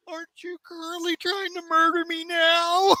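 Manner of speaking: high, strained comic cry; Spider-Man. Retold or imitated. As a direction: wail it in a stretched, high voice. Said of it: "And Spider-Man says".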